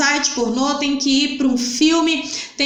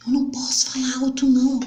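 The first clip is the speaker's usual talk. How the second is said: fast breathy whisper into the phone; much faster than usual. Acted. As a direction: drop to a secret whisper. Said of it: "Ela começa a falar baixinho".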